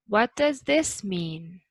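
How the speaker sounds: slow, up on does, then clearly down on mean; falling at the end. Shown as a demonstration. Teacher stressing the falling end of the question. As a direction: drop your voice on mean. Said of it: "Going down is the most important part at the end".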